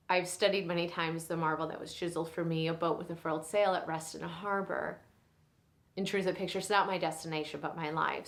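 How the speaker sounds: brisk, run-through quoting of a poem; quick recital. Retold or imitated. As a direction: rattle the verse off quickly in an everyday voice. Quotes the poem's opening while explaining.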